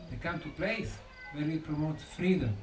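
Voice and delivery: ordinary talk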